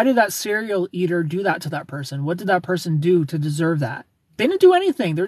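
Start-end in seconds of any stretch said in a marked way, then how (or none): 4.4-5.1 high, loud indignant cry; outraged outburst. Real, not acted. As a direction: jump up in pitch defending the victim. Defending victims against narcissist.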